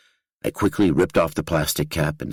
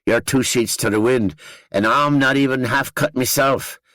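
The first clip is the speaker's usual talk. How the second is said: high, chummy Northern Irish banter; Danny, thick accent; 7 semitones higher than usual. A narrator's reading. Danny inviting the narrator for a pint, thick Northern Irish accent. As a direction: josh him along in a broad Northern Irish voice. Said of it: "His accent thickened".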